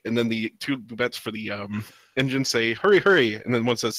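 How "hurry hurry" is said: urgent hurry. Acted. Engine Boobettes urging the train.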